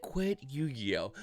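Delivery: slow, soft, solemn divine voice; lightly hammed-up voice of God; mock voice of God. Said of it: "he said".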